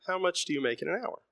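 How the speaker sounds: put-on pleading child. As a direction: ask it again as a desperate little plea. Son begs his dad.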